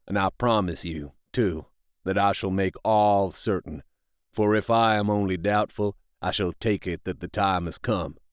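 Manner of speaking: low, grave vow of a rough man; solemn rough fellow. A narrator's reading. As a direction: swear it low and steady. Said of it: "said solemnly".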